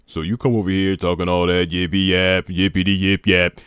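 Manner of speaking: loud, put-on deep voice; mock deep "real voice". Shown as a demonstration. Confronting someone talking yippee yap, loud mock deep voice. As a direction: boom it out in a fake deep voice. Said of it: "This is my real voice".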